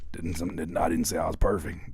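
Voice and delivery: low quoted denial; deep mocking voice. Quoting our friend earlier, deep mocking voice. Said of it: "In the words of our friend earlier".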